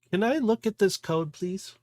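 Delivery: high, sweet little creature voice; pixie voice. Acted. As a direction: ask it like a tiny eager sprite. Caracal asks politely.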